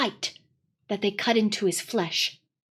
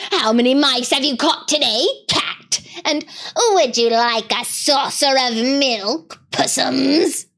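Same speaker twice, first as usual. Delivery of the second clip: jeering; 3 semitones higher than usual. Acted. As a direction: taunt him sweetly and cruelly. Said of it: "jeering at him".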